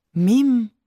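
loud startled question; wide-eyed surprise. A narrator's reading. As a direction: caught completely off guard. Boy answers with wide eyes.

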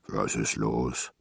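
low, slow giant's question; big figure speaking deep and unhurried; friendly giant. A narrator's reading. Giant Simon speaks in story.

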